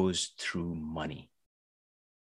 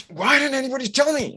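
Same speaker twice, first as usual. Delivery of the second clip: imitated angry user; 13 semitones higher than usual. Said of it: "they would get angry".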